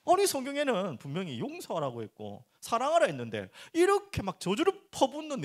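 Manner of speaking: ordinary delivery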